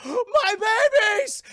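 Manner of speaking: high, slow, drawn-out wail; anguished mother wail. Retold or imitated. Monster mother wailing over her burned nest. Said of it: "the female Mewtwo's like".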